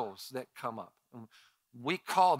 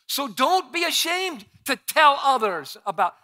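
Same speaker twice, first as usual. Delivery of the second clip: preaching; 10 semitones higher, 10 dB louder than usual. Acted. Preaching the verse.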